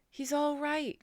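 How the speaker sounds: high, loud anxious cry; flustered farmer crying out; cried out. A narrator's reading. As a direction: shout reassurance fast, near panic. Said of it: "cried Mister Zuckerman".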